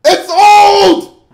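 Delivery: loud, high shout; exasperated shout. Real, not acted. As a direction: yell it out in sheer exasperation. Exasperated outburst in rant.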